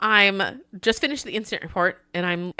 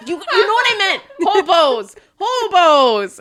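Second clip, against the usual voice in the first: high, loud mock protest; hammed-up exasperated insistence; flustered correction; 8 semitones higher, 6 dB louder, slower than usual. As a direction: protest the slip, voice shooting up. Retold flustered correction to supervisor.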